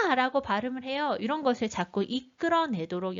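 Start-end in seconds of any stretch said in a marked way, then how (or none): 0.1-0.1 아 starting high and falling sharply; Mandarin fourth tone. Shown as a demonstration. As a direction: drop 아 from high to low. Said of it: "사 성이요".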